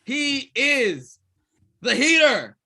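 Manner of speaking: slow, drawn-out wrestling announcer; ring announcer. Acted. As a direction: stretch out the name for the crowd.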